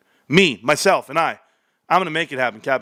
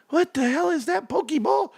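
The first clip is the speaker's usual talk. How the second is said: high mocking stranger voice, thrown off; mock scoffing stranger; 10 semitones higher than usual. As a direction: play a baffled onlooker sneering at the wallet. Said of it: "They're gonna say".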